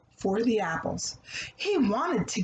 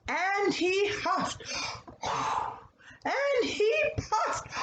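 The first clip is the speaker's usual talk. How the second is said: rising higher and higher into a shout; building huffing; 10 semitones higher, slower than usual. A narrator's reading. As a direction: pile on the effort with each huff. Wolf blows ever harder.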